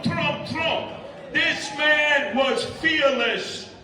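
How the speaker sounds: ordinary talk, nothing marked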